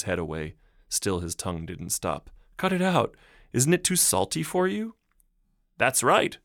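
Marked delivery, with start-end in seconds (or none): 2.6-5.0 much higher, louder, laughing protest; half-laughing, shoving the dog away; teasing the dog through giggles; giggly protest. Acted. Dog keeps licking her. 5.8-6.4 louder, higher eager exclamation; mayor crying out excitedly. Acted. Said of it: "cried the mayor".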